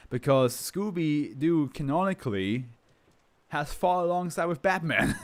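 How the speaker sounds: giggling through the words; laughing while telling fans the Batman fact. Real, not acted. Explaining to viewers while laughing.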